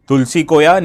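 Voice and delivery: ordinary talk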